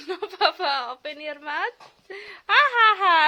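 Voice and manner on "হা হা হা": crying. Real, not acted. Child opens mouth, crying out.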